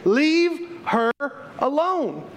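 a hint of Jesus telling the guests off; firm rebuke. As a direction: stop them, lightly voiced as Jesus. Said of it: "Jesus begins to rebuke them".

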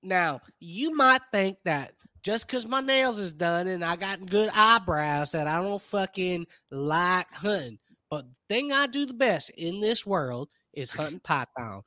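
hammed-up Southern country speech; Amy Sue. Snake huntress explains her python hunting, thick Southern twang. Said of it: "My name Amy Sue".

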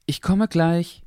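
put-on a boy calling to the kitchen. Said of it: "rief Henry". (rufender Junge)

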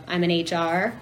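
ordinary delivery

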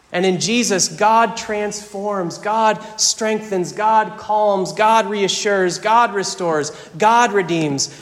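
triumphant pulpit litany; preached litany. Acted. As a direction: proclaim every God like a drumbeat.

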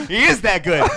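laughing loudly in disbelief. Real, not acted. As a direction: laugh through the words.

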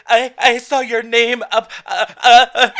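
high, loud stammer breaking into sobs; imitating her crying friend; mock panicked stammer. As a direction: choke on the words.

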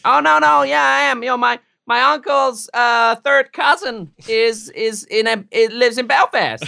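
high, loud eager insisting; hammed-up American insisting on Irish roots; mock eager American. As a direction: gush about a distant Belfast cousin.

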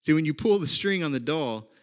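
normal talking voice

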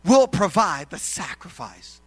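loud, slow, weighty declaration; emphatic declaration. Acted. Abraham answers his son about the sacrifice, emphatic. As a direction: declare it firmly, stretching the words. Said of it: "And Abraham says".

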